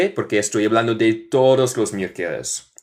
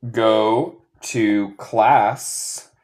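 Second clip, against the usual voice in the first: slow model reading; much slower than usual. Shown as a demonstration. Teacher dictating an example to students, slow model reading. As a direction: go to class, word by spaced word.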